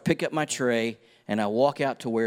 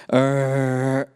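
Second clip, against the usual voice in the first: imitated screech; 2 semitones higher, 4 dB louder than usual. Glass breaks, crowd noise stops.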